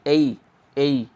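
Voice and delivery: एई drawn out slowly as a gliding "ay-ee"; diphthong demo. Shown as a demonstration. Teacher shows how A is pronounced, diphthong demo. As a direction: stretch एई, sliding from "e" into "i". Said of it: "ए को क्या pronounce किया".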